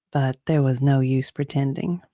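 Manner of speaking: ordinary delivery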